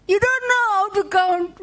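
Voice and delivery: high, squeaky show-off bunny; boastful rabbit. Acted. Rabbit boasts to Anansi about counting, high squeaky bragging. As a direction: brag in a giggly high voice. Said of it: "So rabbit says".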